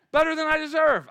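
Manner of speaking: high, loud, quick repeat of the answer; excited answer. Shown as a demonstration. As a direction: fire it back with joy. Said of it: "What do you say?".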